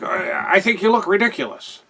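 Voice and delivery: lightly imitating a snooty announcer; Lord Alfred Hayes. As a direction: pitch it up, pompous announcer jab. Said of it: "Hayes is like".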